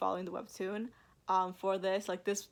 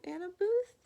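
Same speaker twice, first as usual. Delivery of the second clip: curious question; 12 semitones higher, 5 dB louder than usual. Real, not acted. Guessing the cover designer.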